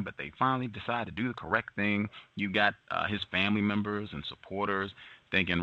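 ordinary delivery

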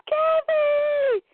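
long, very high cry of a name; acted desperate call. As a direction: call out in mock panic.

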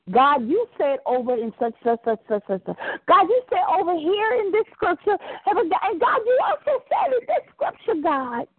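rising high, urgent reminder to God; fervent prayer. Shown as a demonstration. Preacher shows the congregation how to remind God of his word, rising fervently. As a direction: plead his own promises back to him, climbing. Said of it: "begin to remind him of his word".

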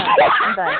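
high squeaky laughing protest. Real, not acted. Host jokingly quits the callers.

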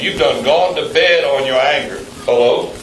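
ordinary talk

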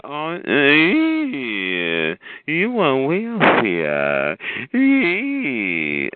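drawn-out, very slow mocking impression; over-dramatized mimicry. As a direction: drag each word out in mockery. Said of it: "she sounds like a over-dramatized".

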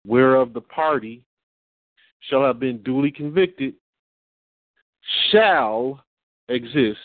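"Slavery", "and" and "shall" set apart by long pauses; pointed corrective reading. Shown as a demonstration. Showing what the amendment means, "and" and "shall" isolated and weighty. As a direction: land firmly on "and" and "shall", each standing alone. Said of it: "read it how it should be read".

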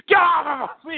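high mock-furious shout; Vince McMahon. Impersonating the boss attacking a wrestler, mock-furious shout. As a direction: shout like an enraged boss starting a fight. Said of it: "gets like he wants to fight somebody".

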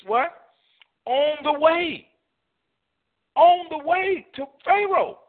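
high, slow, sung-out preaching; whooping preacher build; rising preacher climax. Real, not acted. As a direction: climb in pitch and stretch each phrase.

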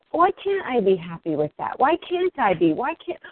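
ordinary talk

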